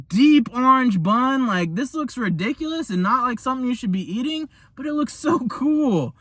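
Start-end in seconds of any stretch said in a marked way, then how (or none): 4.8-6.2 gushing. Real, not acted. Gushing over the burger again.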